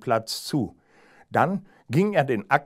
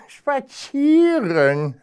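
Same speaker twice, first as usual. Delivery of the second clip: high, slow, drawn-out scornful question; mocking hare; 14 semitones higher, 6 dB louder, much slower than usual. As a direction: stretch the word out in disbelief, high. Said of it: "lachte der Hase spöttisch".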